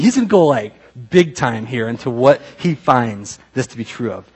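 normal talking voice

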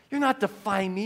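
ordinary talk, nothing marked

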